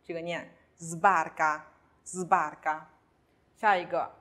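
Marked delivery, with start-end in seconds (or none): 0.8-2.8 sbarca starting with buzzed zb; voiced s. Shown as a demonstration. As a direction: model sbarca slowly, initial s as z. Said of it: "s句首加上了浊辅音b".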